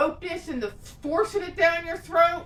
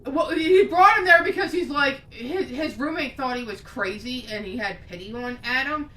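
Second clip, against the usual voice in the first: normal talking voice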